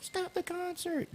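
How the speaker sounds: very high, slow mock scream; imitated crowd yelling. Podcaster retelling how the crowd begged the performer, high mock yell. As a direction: mimic desperate yelling without the volume. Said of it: "people were screaming, yelling, like".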